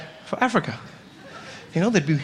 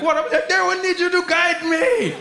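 higher, slow mom voice; imitating mom; 10 semitones higher, slower than usual. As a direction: play the earnest mother, high and slow. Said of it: "she'd come in and be like".

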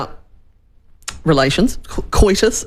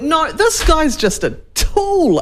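exasperated; 9 semitones higher, slower than usual. Retold or imitated. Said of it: "I went".

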